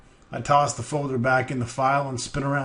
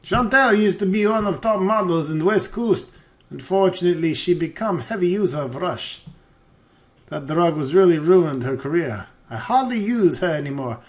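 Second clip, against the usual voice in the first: higher, hammed-up Russian-French mix; bad Russian accent; 5 semitones higher than usual. Crook explains Chantel to detective, in bad Russian. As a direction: gossip like a bad foreign crook. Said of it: "My Russian and French are identical".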